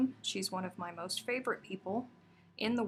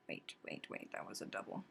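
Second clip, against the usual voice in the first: whispering; 12 semitones lower, 14 dB quieter than usual. Real, not acted. Noticing a stitch mistake.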